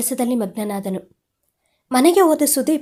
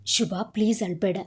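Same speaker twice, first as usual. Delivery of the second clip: soothing; 4 semitones lower, 6 dB quieter than usual. A narrator's reading. Soothing his crying wife.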